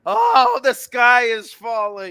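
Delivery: high mock wail, the sky is falling; mocking panic. Mocking fans who panic after a loss, high and loud. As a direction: ham up the doom-mongers' panic. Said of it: "hear all this".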